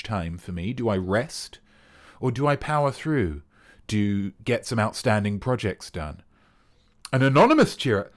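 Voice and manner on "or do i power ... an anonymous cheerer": normal talking voice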